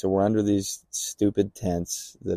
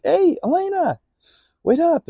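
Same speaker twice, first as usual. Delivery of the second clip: high-pitched call to a friend; 4 dB louder than usual. Retold or imitated. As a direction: call out to catch someone, voice pitched way up. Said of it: "I kinda yell at her".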